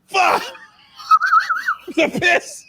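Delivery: high, shrill-free panicked shout of the drummer; drummer's panicked yell. Retold or imitated. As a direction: yell it like he just got splashed with piss. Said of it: "your drummer voice isn't even that accurate".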